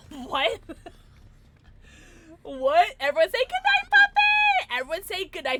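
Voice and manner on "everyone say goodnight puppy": very high, loud yell; excited shout. Real, not acted. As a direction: scream it out to the whole chat. Yelling for chat to say goodnight.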